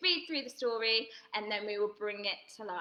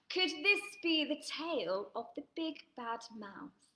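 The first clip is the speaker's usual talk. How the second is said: plain reading voice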